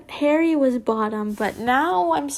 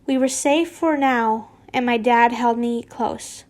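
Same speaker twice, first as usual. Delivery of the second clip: ordinary delivery, nothing marked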